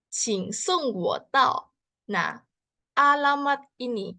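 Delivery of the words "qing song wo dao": "Qing song wo" drawn out slowly; slow Mandarin. Shown as a demonstration. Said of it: "gimana cara bicaranya". (pelafalan Mandarin pelan)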